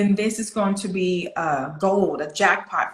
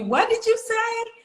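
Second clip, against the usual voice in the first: high-pitched, exaggerated questioning voice; puzzled listener; 8 semitones higher than usual. People asking an accented speaker to repeat, high-pitched puzzlement. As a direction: mimic someone who can't understand, pitched way up. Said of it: "people can stop asking you".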